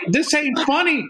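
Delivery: high indignant complaint; mock protest. Victim protesting the dildo attack, high mock indignation. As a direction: protest though you find it funny.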